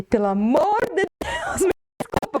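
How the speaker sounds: pleading apology. Retold or imitated. Client retelling the audio message sent to the musician, pleading apology. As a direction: beg forgiveness, reenacting your own embarrassed audio message.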